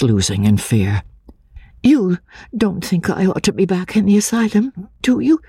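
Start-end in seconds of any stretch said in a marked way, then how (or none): 1.8-5.4 put-on high, timid voice of a fearful girl; frightened young woman. A narrator's reading. Anne, fearing the asylum, asks the narrator in a high timid voice.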